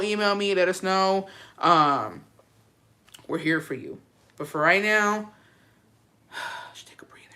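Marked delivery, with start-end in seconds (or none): 6.3-7.3 very soft, breathy exhale; sighed whisper. Real, not acted. Telling the listener to relax, whispered on a sigh. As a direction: sigh it out almost voiceless. Sighing out a calming instruction.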